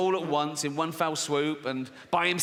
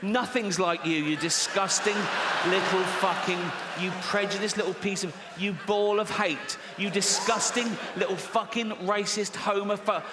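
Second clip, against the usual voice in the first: mock outrage; 4 semitones higher than usual. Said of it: "mock outrage at audience".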